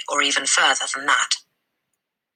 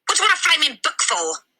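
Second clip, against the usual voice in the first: scornful father; 12 semitones higher than usual. Acted. Father scolds Matilda.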